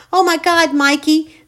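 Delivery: loud, high relieved cry; frantic mother calling. Acted. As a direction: call out to her lost son. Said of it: "his mother crying".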